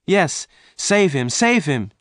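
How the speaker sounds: high, insistent cry; urgent plea. A narrator's reading. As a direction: beg the stranger to step in. Jane begs again.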